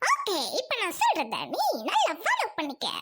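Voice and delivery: exaggerated swooping put-on voice; vain, huffy caricature of a neighbour; touchy dance teacher. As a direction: scold in a sing-song with big pitch swings.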